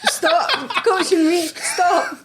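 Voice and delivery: high, slow and laughing; laughing mock protest. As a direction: beg him to stop through laughter. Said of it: "I had to be like".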